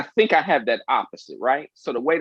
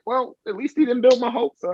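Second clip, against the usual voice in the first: high mock consolation; 12 semitones higher than usual. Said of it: "but you like".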